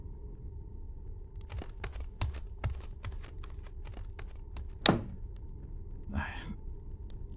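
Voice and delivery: long, heavy whispered sigh. Acted. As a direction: breathe out all the frustration. Frustrated alone after the quarrel.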